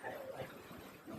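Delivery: a low, unvoiced mock complaint; imitating a quiet complaint. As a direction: mutter it under your breath, a bit fed up. Speaker voicing someone fed up.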